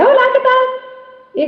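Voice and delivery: very high, drawn-out artificial greeting; slow hello pushed way up and out; voice thrown outward. Acted. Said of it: "totalmente hacia afuera". (voz aguda, totalmente hacia afuera)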